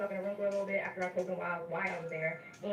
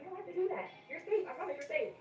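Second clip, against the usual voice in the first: high, soothing nurse voice; calm her down like a sweet nurse would; reassuring nurse; 10 semitones higher than usual. Retold or imitated. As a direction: nurse promising a scared patient she is safe, high and soothing. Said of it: "was like".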